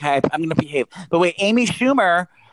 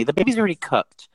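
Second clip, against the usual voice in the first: normal talking voice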